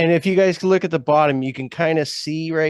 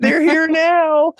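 high, slow teasing drawl; playful drawn out; 8 dB louder, much slower than usual. Real, not acted. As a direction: stretch it out playfully. Stretched playful emphasis.

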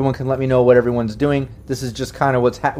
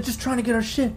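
high, softer captive voice; pleading prisoner; 11 semitones higher, 6 dB quieter than usual. Acted. Game master as prisoner explaining the lost cargo, pleading. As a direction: explain yourself meekly to captors.

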